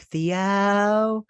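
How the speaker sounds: high, loud drawn-out call. Retold or imitated. Mother yelling for her son down the hole. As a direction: yell down into a hole. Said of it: "yelling in the hole".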